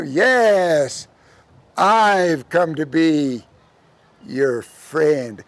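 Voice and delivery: slow, stretched-out luring voice; El Diablo character. Acted. Devil reassuring the girl, slow and sinking low. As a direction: draw it out, dropping low at the end.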